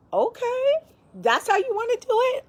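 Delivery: high, mock-indignant challenge; sassy challenge. Real, not acted. Mom daring her loud kids, high and sassy.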